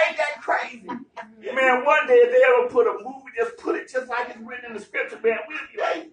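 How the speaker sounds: ordinary talk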